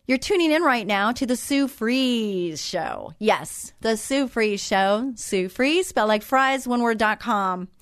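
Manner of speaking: slow, announcer-style station promo; unhurried host plug for the show; radio show plug. Acted. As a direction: sell the show like a radio ident.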